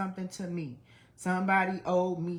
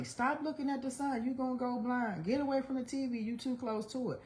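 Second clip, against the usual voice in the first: higher-pitched mimicked parental warnings; retold scolding; 8 semitones higher, 4 dB quieter than usual. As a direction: imitate grown-ups nagging a child, pitched up. Retold adults scolding a child.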